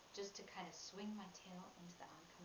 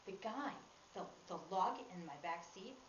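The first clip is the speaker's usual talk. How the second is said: usual speaking voice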